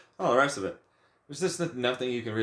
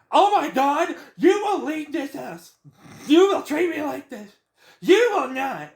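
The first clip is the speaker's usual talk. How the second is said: much higher and louder, hammed-up indignant whine; mocking high outrage; 7 dB louder than usual. Ranter mocks family member's outrage, high loud parody.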